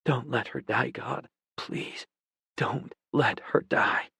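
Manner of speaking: desperate whispered plea; whispered prayer. Acted. Dawson prays for London's life, whispering. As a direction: beg God under his breath.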